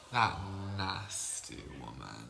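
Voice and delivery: low, drawn-out catty contempt; slow, deep, gossipy loathing; mock disgust. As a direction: drag out the disdain, sinking low in pitch. Mock disgust at a gossiped-about woman.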